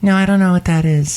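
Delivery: chirpy high gift shop lady; high character voice. Acted. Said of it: "It's Shelley Shuhart".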